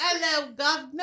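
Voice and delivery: mock British. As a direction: greet like a stage Londoner. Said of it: "I'm British".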